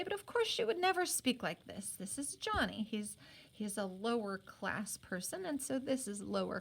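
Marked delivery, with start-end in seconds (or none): none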